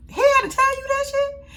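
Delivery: loud, high-pitched incredulous scolding; indignant disbelief. Acted. Vlogger scolding Hope over the picture, loud high disbelief. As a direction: raise pitch and volume in disbelief.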